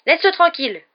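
high, loud cry at the snake; shouted command. A narrator's reading. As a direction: shout to make it back off. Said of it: "il cria tout bêtement au serpent". (ordre crié, aigu et fort)

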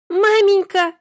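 put-on high tearful cry of a child. A narrator's reading. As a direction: wail it like a small crying girl. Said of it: "заплакала Лиза". (плачущий ребёнок)

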